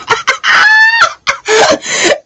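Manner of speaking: very high shrieking laugh; a squeal of delight. Real, not acted. Host shrieking with laughter at the song idea, shrieking laugh.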